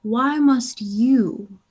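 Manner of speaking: slow, drawn-out exasperated sigh of a question; slow theatrical exasperation; weary slow lecture. Acted. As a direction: spell it out like a tired teacher.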